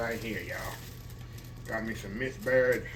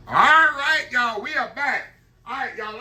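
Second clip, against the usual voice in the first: excited greeting; 12 semitones higher, 8 dB louder than usual. Real, not acted. Cheerful return to camera.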